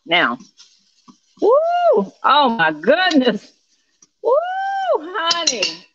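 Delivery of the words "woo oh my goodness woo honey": high woo then oh my goodness; excited whoop. Real, not acted. Cook exclaiming to viewers over the smell, excited. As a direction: burst out in delight.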